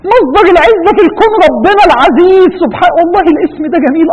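high, loud proclamation of praise; fervent preaching. Real, not acted. Preacher proclaiming God the source of honour, fervent.

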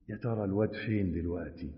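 hushed temptation. Acted. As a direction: murmur the forbidden thought. Soul whispering temptation.

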